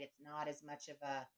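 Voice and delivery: ordinary talk, nothing marked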